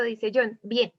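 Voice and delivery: ordinary talk, nothing marked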